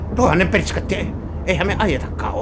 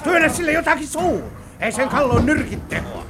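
agitated; 8 semitones higher than usual. Acted. Struggling to silence screaming captive.

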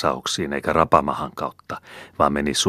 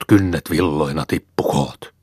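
whispered spell; 4 dB louder than usual. Acted. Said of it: "äyski".

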